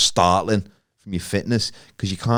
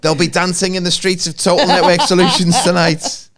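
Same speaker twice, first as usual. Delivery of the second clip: higher, louder excited presenter call; Jeff Stelling impression; 8 semitones higher, 6 dB louder than usual. Quoting the famous Soccer Saturday line, excited presenter. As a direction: deliver it like a giddy football pundit. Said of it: "remember that line on Soccer Saturday".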